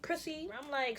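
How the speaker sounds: ordinary talk, nothing marked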